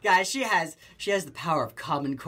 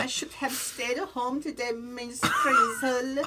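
higher, slow, drawn-out whine; lightly voiced whining kid, dragging out "Miss Frizzle"; a hint of a sulky schoolboy pleading with his teacher; whiny Arnold voice; 7 semitones higher, much slower than usual. Imitating Arnold's catchphrase.

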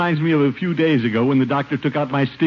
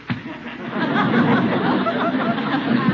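long, high, rolling chuckle; big laugh. Acted. Gildersleeve laughing at his niece's joke, a long high chuckle. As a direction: let out a booming, giggling laugh.

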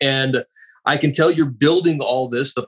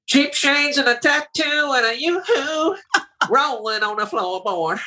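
much higher, slow, pinched nasal voice; 12 semitones higher than usual. Retold or imitated. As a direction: hold your nose and drawl it. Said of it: "you have to hold your nose to do it".

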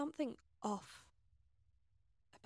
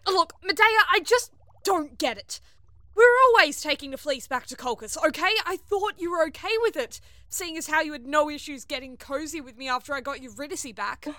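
loud, high-pitched frustration; exasperated argument; 5 semitones higher, 13 dB louder than usual. Acted. Crewmate disputes plans for the fleece, exasperated. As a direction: argue hard, voice raised. Heated quarrel over the fleece.

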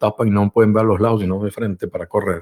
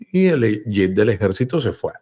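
ordinary talk

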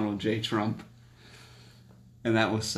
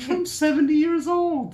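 high, slow disbelieving retort; are you serious, said up high and slow; incredulous; 5 dB louder than usual. Retold or imitated. Telling friends who praise Trump, mock incredulous and high. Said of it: "I'm like".

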